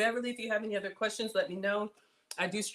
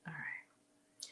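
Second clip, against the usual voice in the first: whispered; 4 semitones lower, 17 dB quieter than usual. Real, not acted. Quiet aside while filming.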